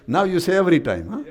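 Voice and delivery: laughing tease. Real, not acted. Preacher playfully correcting a child's answer, laughing.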